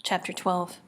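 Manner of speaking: ordinary delivery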